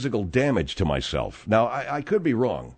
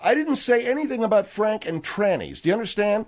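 raised pitch, indignant insistence; exasperated denial; 8 semitones higher than usual. Acted. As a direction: push back, exasperated, pitch riding high. Argument over what he said.

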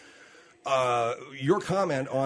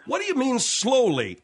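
exasperated; 7 semitones higher than usual. Real, not acted. Exasperated at being told slower.

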